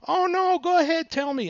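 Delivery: very high put-on voice; coaxing insistence. Seller urging buyer to tell the number, coaxing insistence.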